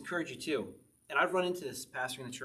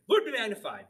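a bright, raised call to the congregation, grinning; playful church call-out; 7 semitones higher, 6 dB louder than usual. Pretend. Pastor teaching the church a new greeting, playful loud call-out. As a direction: proclaim it higher and louder with a laugh in it. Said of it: "Say to the people".

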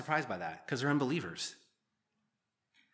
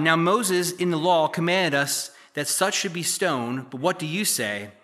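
louder, measured Bible reading voice; scripture reading; 6 dB louder than usual. Acted. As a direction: read the passage out to the congregation. Reads the scripture passage aloud.

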